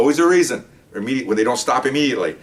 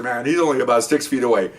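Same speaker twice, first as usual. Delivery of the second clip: ordinary talk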